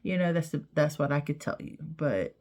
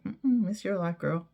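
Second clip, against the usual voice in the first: ordinary talk, nothing marked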